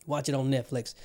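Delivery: normal talking voice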